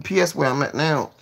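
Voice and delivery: usual speaking voice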